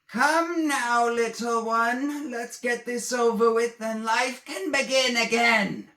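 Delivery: a monstrous queen luring her prey; spider queen voice. Acted. The stained glass spider queen taunting Egan, eerie high voice.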